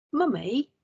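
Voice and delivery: questioning repeat. Acted. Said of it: "I repeated".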